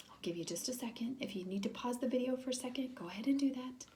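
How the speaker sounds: soft hushed aside; quiet whisper to the family. Real, not acted.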